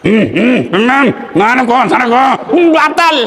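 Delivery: hummed, half-suppressed correction; comic muffled reprimand during prayer; mimed prayer scolding. Acted. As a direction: signal through hums, then blurt it out. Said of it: "Tegurnya begini".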